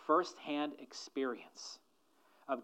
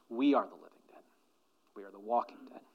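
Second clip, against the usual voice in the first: ordinary delivery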